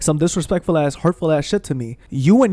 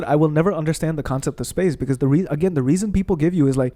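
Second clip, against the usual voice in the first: usual speaking voice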